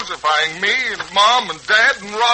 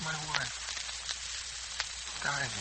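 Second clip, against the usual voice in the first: broken, much softer words with long gaps; stunned grief; 3 semitones lower, 20 dB quieter than usual. Acted. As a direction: barely voice the loss. Father fears for dying wife.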